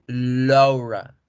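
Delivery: Laura said lora with rounded lips and a dark l; Laura as English lora. Shown as a demonstration. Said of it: "debo redondear mi boca como si fuese a hacer una O".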